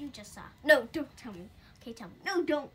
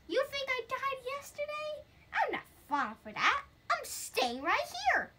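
higher, teasing ghost who won't budge; 7 semitones higher, 5 dB louder than usual. Acted. Ghost scolding the reader for scaring the cat, higher teasing voice. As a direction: play the stubborn ghost, higher and cheeky.

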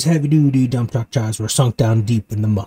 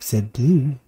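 ordinary delivery, nothing marked

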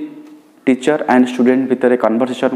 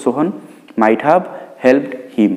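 slow dictation; slower than usual. Shown as a demonstration. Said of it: "ମନେ ରଖିବା ପିଲାମାନେ".

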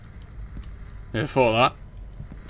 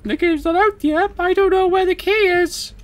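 much higher, silly squeaky voice; 13 semitones higher, 3 dB louder than usual. Pretend. Gamer squeaking in panic at the locked door. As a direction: pitch right up like a cartoon. Playing horror game, high squeaky voice.

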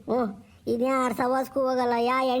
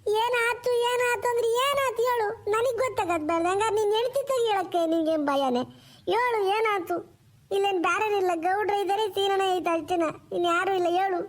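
high pleading wife voice, slowed; anxious wife pressing her husband to talk; worried wife; 6 semitones higher than usual. Acted. Wife demanding to know what happened in Tumkur, high and anxious. Wife voice held by same actor.

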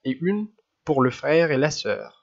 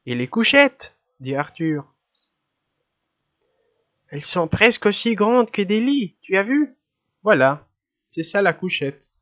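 put-on excited boy; 6 semitones higher than usual. A narrator's reading. Arthur excited over the bunks.

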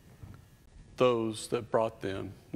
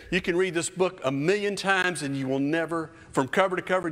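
usual speaking voice

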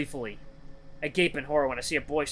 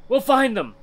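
loud, raised snarl; 9 semitones higher, 7 dB louder than usual. Acted. Hound afraid for the missing children, loud frustrated snarl. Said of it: "The hound snarls".

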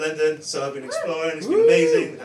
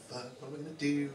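despairing mutter; 4 semitones lower, 12 dB quieter, much faster than usual. Retold or imitated. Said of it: "just like".

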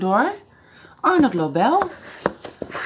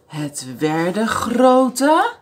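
ordinary delivery

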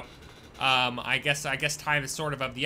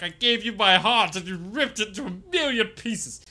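hammed-up melodrama, louder and higher; mock heartbroken; 6 semitones higher, 5 dB louder than usual. Streamer to Cass, mock heartbreak. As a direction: play a jilted lover over a lost save. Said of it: "I would have just been like".

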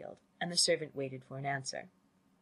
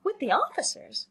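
high-pitched surprised question; crying out; 12 semitones higher, 4 dB louder than usual. A narrator's reading. Lydia reacting to the note read aloud, crying out. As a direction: exclaim it in girlish surprise. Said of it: "cried Lydia".

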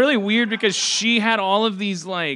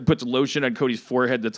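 ordinary talk, nothing marked

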